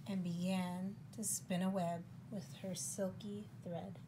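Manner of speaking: soft, measured story reading; picture-book narration. A narrator's reading. Reader telling children about a very busy spider, storybook narration. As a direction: read it gently to young children.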